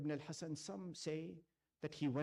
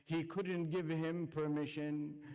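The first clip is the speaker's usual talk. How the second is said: impassioned preaching. Acted. Preacher recounting Ashura to mourners, impassioned lament. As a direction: pour grief into every phrase, building. Preacher's rising Karbala recitation.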